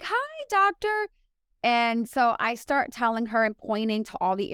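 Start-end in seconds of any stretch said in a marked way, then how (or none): 0.0-1.1 high, drawn-out hi; mock polite greeting. Patient greets the curt podiatrist, mock polite. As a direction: re-enact a high, slow, over-sweet hello. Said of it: "I'm like".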